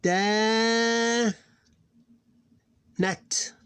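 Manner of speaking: "Da" held long, "Nat" short; two syllables. Shown as a demonstration. Teacher showing two syllables, long "Da", short "Nat". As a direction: sustain "Da", pause, brief "Nat". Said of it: "Two syllables".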